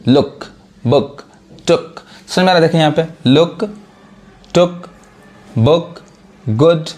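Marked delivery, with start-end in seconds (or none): 0.1-2.0 लुक, बुक, टुक each with a short, quick "u"; short sound. Shown as a demonstration. Teacher demonstrating fast short sound, short "u" in लुक, बुक, टुक. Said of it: "जो sound बिल्कुल fast पढ़ा है". 3.3-6.9 लुक, टुक, बुक, गुड with brief "u", word by word; quick short vowel in लुक, टुक, बुक, गुड; short sound. Shown as a demonstration. Teacher showing the fast reading, short "u" in लुक, टुक, बुक, गुड. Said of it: "यानी तेज से पढ़ा रहा है".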